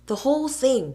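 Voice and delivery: high, loud declaration; defiant. A narrator's reading. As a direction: declare it boldly. Billy deciding.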